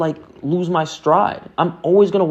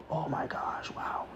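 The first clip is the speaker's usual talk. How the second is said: breathless whispered awe, much softer; mock swoon whisper; 19 dB quieter, slower than usual. Imitates being smitten with a guy, whispered mock awe. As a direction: whisper it like dazzled by a crush.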